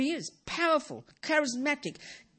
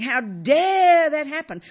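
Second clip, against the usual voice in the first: slow, loud, higher outburst of indignation; mock indignant outrage; 6 semitones higher, 11 dB louder, slower than usual. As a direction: play anger itself crying out at the world. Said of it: "Anger is saying".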